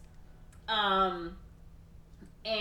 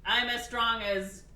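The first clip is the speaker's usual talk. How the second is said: stilted recitation; 5 semitones higher, 4 dB louder than usual. Acted. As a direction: recite someone else's words stiffly, mock-awkward. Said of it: "very stilted".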